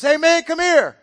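high, loud parrot call; talking parrot. Acted. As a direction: call out pushily as a cheeky parrot. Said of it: "that parrot goes".

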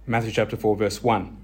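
ordinary delivery

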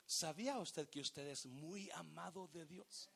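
ordinary talk

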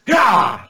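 high strained action-hero yell; mock fight cry, high and short; Jean-Claude Van Damme. Imitating the action star's look, high fighting yell. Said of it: "Van Damme is just".

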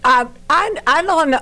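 flustered stammer; tripping over words in embarrassment; stuttering. Real, not acted. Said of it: "I'm stuttering 'cause I'm so embarrassed".

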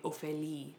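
"Ophélie" said softly, the French way; "Ophélie" with French vowels, ending on "lee"; French pronunciation. Retold or imitated. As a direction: copy a French speaker saying "Ophélie". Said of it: "she says it just like that".

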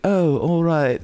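lightly put-on English voice. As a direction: imitate a polite English acknowledgment. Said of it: "And he's like".

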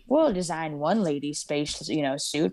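transatlantic working stiff; lightly voiced old engineer; old-timey engineer voice. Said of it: "[name]'s voice for someone designing this is like Orville Redenbacher".

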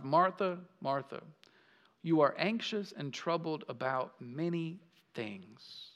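soft, low gentle rebuke; compassionate tender. Retold or imitated. Jesus gently calling out to anxious Martha, softly tender. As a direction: speak her name with tenderness. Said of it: "in a compassionate, tender tone".

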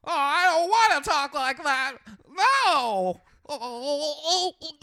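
loud, high hammed-up wailing protest; mock anguish. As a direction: wail it comically, far above your voice. Resisting conversion to deep voice.

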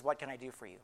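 low, soft offer of help; quiet, kindly question; gentle Jesus. Acted. Repeats Jesus's tender question.

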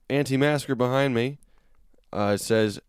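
usual speaking voice

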